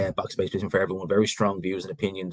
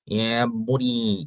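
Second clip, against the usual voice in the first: drawled, mocking inner city Dublin accent; put-on Dublin accent, very slow; 3 semitones higher, much slower than usual. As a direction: stretch the vowels in rough inner-city Dublin. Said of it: "very much an inner city Dublin accent".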